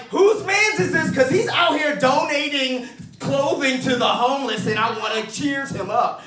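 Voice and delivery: loud, high proud callout; hammed-up positive shout; mock cheerful yell. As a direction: shout praise for the stranger. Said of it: "no one is like".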